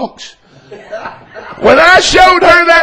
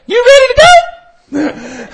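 high, drawn-out imitation of a wife; perky wife; 9 semitones higher, slower than usual. Wife asks husband across the table to leave, perky imitation. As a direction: pipe up perkily, pitched well above your own voice. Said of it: "little perky self, she spoke up".

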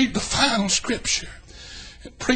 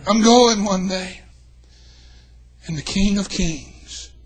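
ordinary delivery

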